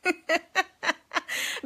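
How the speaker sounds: long amused laugh; real laugh. Real, not acted. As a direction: laugh it off freely. Laughs at her own story.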